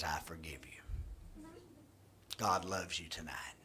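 whispered, soft and intimate; tender whisper. Acted. Pastor voicing God's forgiveness, in a whisper. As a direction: breathe it like a gentle promise.

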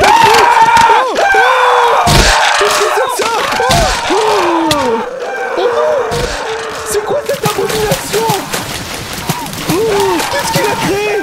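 loud, high cries of horror; horrified panic. Acted. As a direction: cry out in panic at the abomination. La créature abominable apparaît. (cris d'horreur paniqués)

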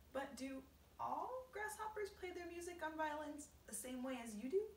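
put-on high-pitched inquiring child figure; curious boy. James asking the grasshopper about others, high curious boy voice. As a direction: ask with wide-eyed curiosity, pitched high.

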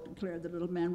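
usual speaking voice

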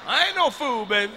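1 cocky boast. Retold or imitated. Boasting to audience.

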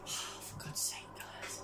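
breathy exasperated whisper under his breath; real fed-up hush. Real, not acted. Player telling viewers about ruined bales, exasperated whisper.

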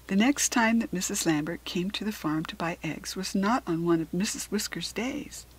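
usual speaking voice

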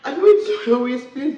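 wife pleading with her angry husband, loud; desperate loud plea. Acted. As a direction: shout over him to be heard.

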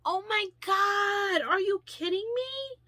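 high, loud, slow squeal of surprise; thrilled shriek. Real, not acted. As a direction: shriek with real delight at the gift. Opening a surprise gift.

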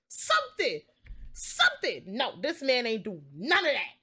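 normal talking voice

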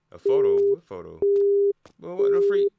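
normal talking voice

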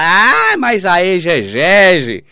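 high, slow mocking voice of a know-it-all; mocking the critics. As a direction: parody the opponent drawing out exegese. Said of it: "Aí eles viram pra mim e falam".

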